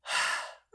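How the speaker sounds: breathy unvoiced sigh; heavy sigh. Mister Tickle gives in with a sigh. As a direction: let out a resigned sigh. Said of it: "Mister Tickle sighed".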